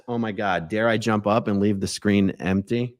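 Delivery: ordinary talk, nothing marked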